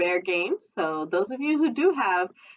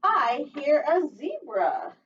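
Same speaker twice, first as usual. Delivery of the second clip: put-on sing-song read-aloud for little kids; storytime reading; 8 semitones higher, slower than usual. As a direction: read it like a lively picture book. Teacher reads picture book aloud.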